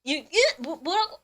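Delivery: stammering, baffled mock reaction; confused test-taker. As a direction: act out a listener who caught nothing. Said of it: "이러시는 분이 많아요".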